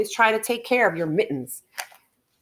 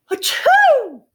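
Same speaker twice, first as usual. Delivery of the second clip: a loud, high mock sneeze; hammed-up bear sneeze; big sneeze; 8 dB louder than usual. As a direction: blast out a huge pretend sneeze.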